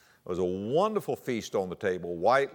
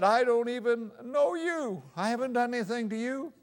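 higher, mock whimpering boy; retold crying plea; 13 semitones higher than usual. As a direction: plead like a scared kid about to cry. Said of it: "He started to cry".

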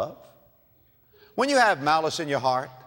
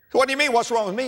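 indignant retort; 9 semitones higher, much faster than usual. Acted. Executive snapping back at the honking man, indignant. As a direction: bristle back at him. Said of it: "so angry".

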